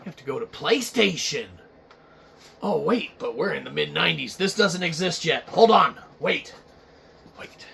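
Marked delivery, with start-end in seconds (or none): none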